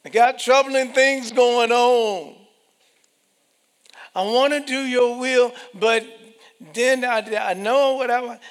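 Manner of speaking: high loud pleading protest; reluctant believer. Acted. Preacher voices a scared believer arguing with God, loud.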